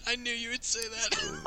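laughing through the words; high, giggling repeat. Real, not acted. Guessed the joke, laughing.

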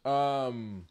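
normal talking voice

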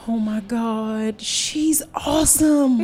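high, slow, gushing admiration; excited fangirl. Retold or imitated. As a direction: gush over your idol. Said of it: "I was like".

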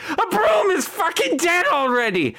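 high-pitched screech; second witch voice. Acted. Other witch interrupts the invitation, high screech. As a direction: shriek it out high and sustained. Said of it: "it's that point that you hear".

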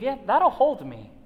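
easygoing believer shrugging at the drop; casual confidence. Retold or imitated. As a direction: toss it off like it's obviously safe. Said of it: "it's one thing to believe".

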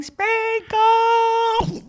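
high, drawn-out mock yell of a name; mock shout. Missus Longfellow calling up open-mouthed, mock shout. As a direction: holler it high, stretching the end. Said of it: "she was shouting".

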